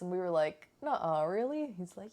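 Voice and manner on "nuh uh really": mock disbelief. Friends react in disbelief.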